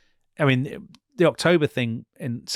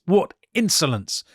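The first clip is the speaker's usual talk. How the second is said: high, loud mock outrage; spat-out indignation; 8 semitones higher, 6 dB louder than usual. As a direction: spit it out like an offended queen. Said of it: "just spits out".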